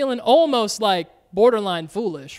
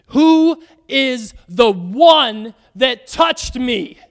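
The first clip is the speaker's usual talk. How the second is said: slow, louder, weighty question; slow solemn question; 2 semitones higher, 7 dB louder, much slower than usual. Retold or imitated. Jesus asking the crowd, slow and weighty. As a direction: let each word land.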